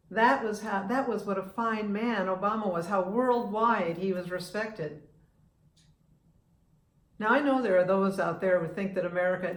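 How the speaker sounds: usual speaking voice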